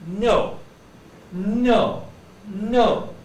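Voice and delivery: "No" with the tongue on the back of the teeth; crisp n in "No, no, no"; tongue-behind-teeth n. Shown as a demonstration. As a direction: press the tongue up for each "no". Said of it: "put my tongue behind my teeth and say".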